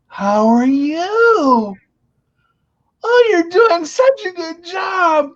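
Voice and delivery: high, drawn-out condescending lady; mock an over-sweet older woman talking down, slowly; signora condiscendente. Patronizing old lady imitated in story.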